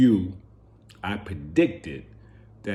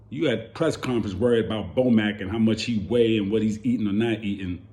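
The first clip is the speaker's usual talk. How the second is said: usual speaking voice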